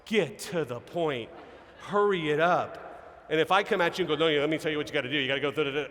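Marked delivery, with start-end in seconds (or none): 0.0-2.8 drawn-out, exasperated urging; mock impatience. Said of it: "those of us that talk faster are like". 4.1-5.9 rushed, rattled-off instructions; fast talker. Acted. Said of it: "those of us that talk faster".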